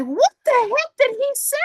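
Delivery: baffled disbelief. Retold or imitated. Confused teen on the bus, hearing the driver, in squealing disbelief. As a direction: sound utterly lost by what you just heard.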